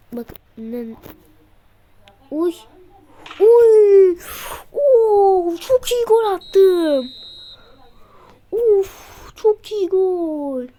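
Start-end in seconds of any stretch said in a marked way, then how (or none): 2.3-10.7 high, loud, drawn-out cheer over the goal; goal celebration. Real, not acted. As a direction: roar it out as if you just scored a screamer. Celebrating his own goal. (gol sevinciyle yüksek ve yüksek sesle bağırarak)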